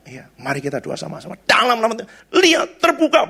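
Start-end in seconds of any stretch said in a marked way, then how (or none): 1.5-3.3 high, punchy imitation of healing commands; mock prayer command. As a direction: act out commanding prayer, pitched up. Acting out forceful healing prayer commands. (menirukan doa perintah dengan nada tinggi)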